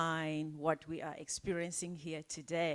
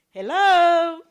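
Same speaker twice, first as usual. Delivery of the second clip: much higher, much louder call of hello; 11 semitones higher, 20 dB louder than usual. Real, not acted. MC hailing the audience mid-introduction, loud. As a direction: call out to wake the room.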